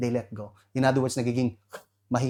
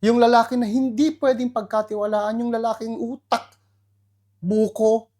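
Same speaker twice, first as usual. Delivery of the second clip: scornful, raised voice for the useless man; mocking scorn; 8 semitones higher, 5 dB louder than usual. The pastor mocking a man with coconut for a brain, louder. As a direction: sneer it out loud.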